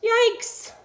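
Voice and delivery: high, loud storyteller's yelp; exaggerated startled exclamation; mock alarm. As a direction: react with playful shock. Reacts to puppy chased with fork.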